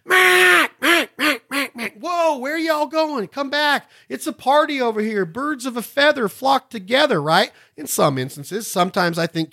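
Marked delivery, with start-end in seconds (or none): 0.1-1.9 loud high first note, then falling off; hail call. Shown as a demonstration. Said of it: "That's what you're telling those ducks". 2.0-7.2 higher, slow, coaxing call to the ducks; playful voice of the call talking to ducks; voicing the duck call. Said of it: "That's what you're telling those ducks".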